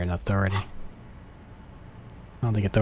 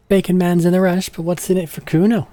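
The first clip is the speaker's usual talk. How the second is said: put-on higher, louder bratty street kid; cocky kid Kuno; 8 semitones higher, 4 dB louder than usual. Streamer voicing a cocky kid who shows off. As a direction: brag like a cocky little punk.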